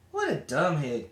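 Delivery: plain reading voice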